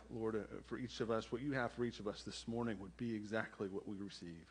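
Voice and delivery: low, hushed pastoral prayer; quiet, low prayer with pauses between phrases. Real, not acted. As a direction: pray softly, well below your preaching voice. Leads the congregation in prayer.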